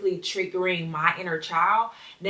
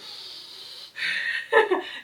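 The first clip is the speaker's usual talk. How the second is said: airy laugh; 4 dB quieter than usual. Real, not acted. Laughing at daughter's phrase.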